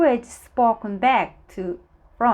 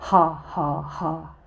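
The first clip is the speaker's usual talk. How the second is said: low, joyless "ha, ha, ha"; doleful laugh; 3 semitones lower than usual. A narrator's reading. Miss Giggle tries to giggle, dolefully.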